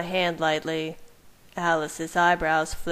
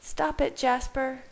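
plain reading voice

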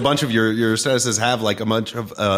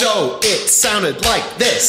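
"So it sounded like this" word by word, high and slow; clapped-out emphasis; 8 semitones higher, much slower than usual. Shown as a demonstration. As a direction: clap out each word of "So it sounded like this". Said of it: "in between the words had the clapping one".

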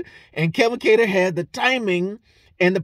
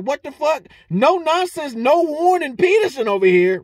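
mock outrage; 8 semitones higher, 4 dB louder than usual. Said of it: "and I was like".

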